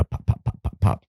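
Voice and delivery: quick popped gunshot noises; imitated gunfire. Storyteller sounding out the firefight, imitated gunfire. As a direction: mouth the popping of rifle shots.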